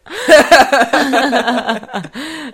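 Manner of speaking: big loud laugh; loud laughter. Real, not acted. Laughs at her own joke.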